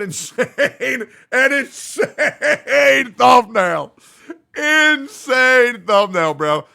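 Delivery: high, drawn-out yell on "insane"; over-the-top hype scream; hyped shout. Real, not acted. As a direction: bellow it slowly, laughing with excitement. Hyping the thumbnail, stretched shouts.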